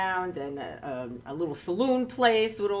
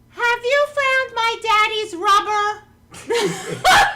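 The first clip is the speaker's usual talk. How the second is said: put-on high, loud, drawn-out child's voice; little daughter; 13 semitones higher, 7 dB louder, much slower than usual. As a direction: innocent kid piping up at full volume. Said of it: "my daughter says".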